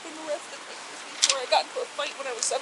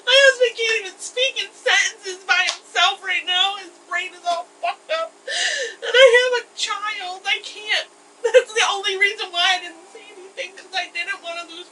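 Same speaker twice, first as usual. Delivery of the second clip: crying; 5 semitones higher, 6 dB louder than usual. Real, not acted. Arrested woman breaks down crying.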